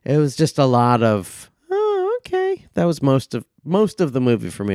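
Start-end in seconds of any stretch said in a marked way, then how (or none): 1.7-2.6 mock reaction.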